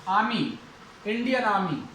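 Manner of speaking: आर्मी said as "aa-mee", without the R; silent R. Shown as a demonstration. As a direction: drop the R in आर्मी, long "aa" into "mee". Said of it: "तो रे का उच्चारण नहीं होगा". (आर्मी को "आमी" बोलें, र चुप)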